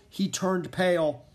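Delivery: plain reading voice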